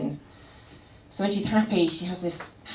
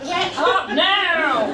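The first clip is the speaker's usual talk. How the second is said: high, slow, loud laughing command; demanding cat; 9 semitones higher, 5 dB louder, much slower than usual. Pretend. Cat waking its owner, high slow laughing command. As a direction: bellow it like a bossy cat at dawn.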